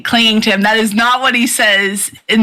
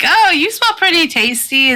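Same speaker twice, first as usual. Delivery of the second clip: Power sizing up prey; 9 semitones higher than usual. Retold or imitated. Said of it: "she's like".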